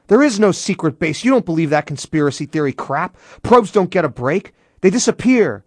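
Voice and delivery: heated, high-pitched shouted protest; shouting. A narrator's reading. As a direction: shout it down in exasperation. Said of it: "Britton shouted".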